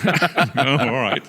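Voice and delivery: high, giggly "oh, all right"; laughing reply. Retold or imitated. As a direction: give in while laughing. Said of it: "And I go".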